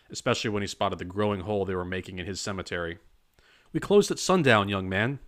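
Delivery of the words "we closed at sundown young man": high, loud reprimand; angry scolding. A narrator's reading. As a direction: scold the young man sharply. Groundskeeper scolding intruders.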